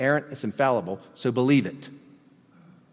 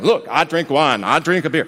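louder, bragging show-off voice; mock boasting; 5 dB louder than usual. Wine drinker boasting to others, mock bragging. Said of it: "Don't go around going".

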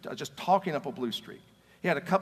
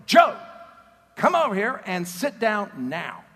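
loud, firm call across the room, slower; insistent call; 4 semitones higher, 8 dB louder, slower than usual. Retold or imitated. Retelling a friend ordering Joe to sit, loud firm call. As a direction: call him over and mean it this time.

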